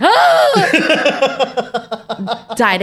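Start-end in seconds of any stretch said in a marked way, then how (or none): 0.0-1.4 high loud shriek Ah, laughing; Ah, a high loud scream mixed with laughter. Retold or imitated. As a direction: scream it while cracking up. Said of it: "when I was like".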